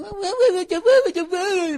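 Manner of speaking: put-on high whiny baby wah-wah; mock whining. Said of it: "Stop going".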